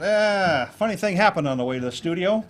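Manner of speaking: mildly hammed-up comic opener; cheesy joke opener. Speaker mocking a cheesy joke opening, lightly hammed up. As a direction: deliver the stale joke like a ham. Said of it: "Come out".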